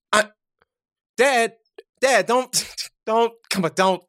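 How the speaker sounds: high, halting protest to a father. Acted. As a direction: stammer a pleading objection. Son protests father's teasing.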